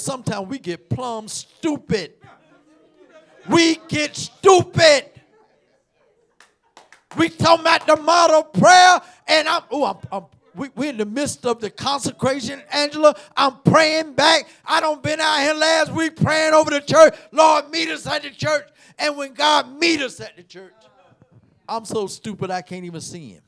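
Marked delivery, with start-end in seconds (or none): 3.5-5.1 loud, high, slow shout of stupid; preacher shouting back his own line; shouted repeat. Acted. 7.2-20.7 loud, high preacher's cry; preacher testifying loudly to the church; full-force preaching. Acted.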